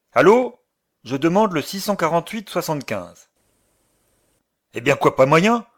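loud impatient phone call; shouting on the phone. Acted. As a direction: bark into the receiver. Shouting into the telephone.

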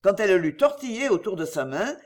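ordinary delivery, nothing marked

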